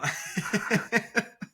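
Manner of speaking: high-pitched burst of laughter. Real, not acted. Laughing at his own comment.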